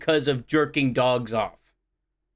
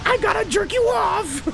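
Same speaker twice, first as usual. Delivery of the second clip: much higher cartoon Shaggy voice; goofy high Shaggy impression; jokey Shaggy to Scooby; slower than usual. Continues cartoon voices after Shaggy remark.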